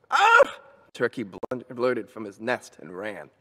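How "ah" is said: very high, loud yelp; sudden high-pitched shriek of alarm; startled scream. A narrator's reading. Turkey fleeing Mister Moose, startled scream.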